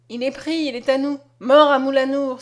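exclaimed, building to a loud finish; pursuers' cry. A narrator's reading. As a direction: cry out in triumph, loudest on the last words. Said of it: "s'écrièrent ceux qui le poursuivaient".

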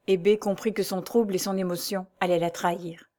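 plain reading voice

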